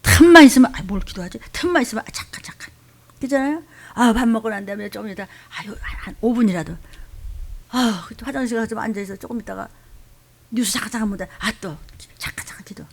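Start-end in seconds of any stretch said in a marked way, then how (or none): 0.7-1.4 mumbling lazy excuse. Retold or imitated. Mimicking lazy believer. 2.1-2.7 whispered lazy stalling. Retold or imitated. Mimicking lazy believer. 12.2-12.8 whispered lazy stalling. Retold or imitated. Mimicking lazy believer.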